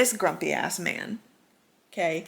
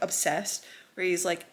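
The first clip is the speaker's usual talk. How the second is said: ordinary talk, nothing marked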